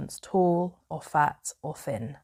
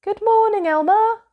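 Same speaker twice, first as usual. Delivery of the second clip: very high, loud sing-song hello; cheery animal greeting; 5 dB louder than usual. A narrator's reading. Jungle animals greet Elmer, very high and loud. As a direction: greet him like a chirpy little jungle creature. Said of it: "They always said".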